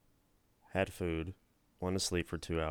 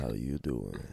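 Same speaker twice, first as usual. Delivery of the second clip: smooth pickup line; 7 semitones lower than usual. Acted. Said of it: "Joey pickup line".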